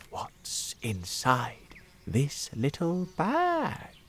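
slow hushed whisper; quiet whisper. Acted. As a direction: whisper it slowly like a secret. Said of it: "the old man said quietly".

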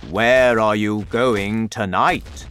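slow, loud call in a big giant voice; giant's shout. A narrator's reading. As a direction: shout it out slowly to others running past. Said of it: "shouted the B F G".

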